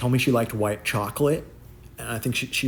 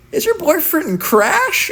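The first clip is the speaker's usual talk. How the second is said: very high, slow, loud question; surprised question; 10 dB louder, much slower than usual. Retold or imitated.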